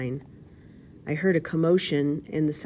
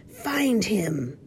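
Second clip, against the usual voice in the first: higher-pitched, chilling villain's order; chilling command; 5 semitones higher than usual. A narrator's reading. Blind man orders his men to search, chilling command. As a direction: command it like a menacing blind pirate. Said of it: "a chilling voice commanded".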